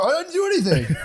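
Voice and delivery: high, laughing whiny denial; mock innocent protest. Partner acting innocent after a jab, mocked in a high laughing voice. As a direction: squeak it like a wronged innocent. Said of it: "And they'll go".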